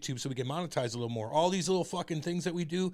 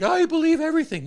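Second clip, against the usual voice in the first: mock gullible voice; 14 semitones higher, 11 dB louder than usual. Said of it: "you can't just be like".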